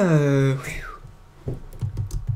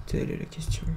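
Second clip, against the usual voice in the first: chuchoté théâtral; 8 dB quieter than usual. Acted. Narration théâtrale de la cachette.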